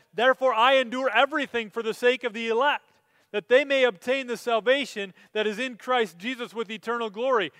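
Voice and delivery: loud, high preached quotation; fervent scripture proclamation; proclaimed scripture. Acted. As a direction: ring out Paul's words to the congregation. Quoting Paul mid-sermon.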